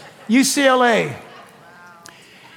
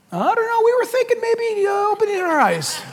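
high-pitched, hammed-up hesitancy; put-on high voice, feeling out the request; mock sheepish answer; 10 semitones higher than usual. Blind men answering Jesus, high mock-sheepish voice.

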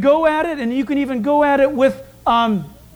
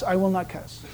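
softer mumbled echo; 5 semitones lower, 8 dB quieter than usual. Shown as a demonstration. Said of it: "Initial that".

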